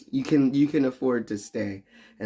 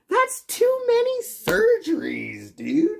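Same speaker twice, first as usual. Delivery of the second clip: squeaky high voice; slower than usual. Acted.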